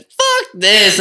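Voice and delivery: high, loud mock outburst; retold exclamation. Retelling walking away from a tripping housemate, high loud exclamation. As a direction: throw it out loudly, giving up.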